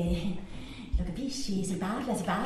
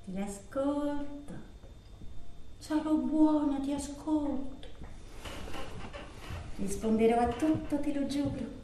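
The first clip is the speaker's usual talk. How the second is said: soft, subdued pleading; submissive promise; 8 dB quieter than usual. Acted. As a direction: promise softly to be good. Submissive promise to lover on phone. (remissiva, a bassa voce)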